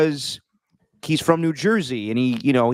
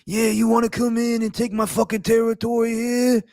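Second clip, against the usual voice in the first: high, put-on gangster voice; mock mobster impression, pitched way up; half-assed mobster voice; 9 semitones higher than usual. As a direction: do a hammed-up mobster, badly faked. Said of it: "doing, like, a half-assed".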